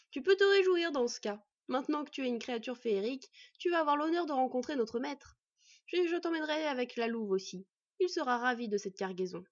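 gloating bandit chief. Acted. Bandit promising boy to his master, gloating. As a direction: crow over the prize.

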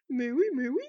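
loud, squawky bird voice, insistent; indignant crow. Acted. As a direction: snap back as an irritated crow.

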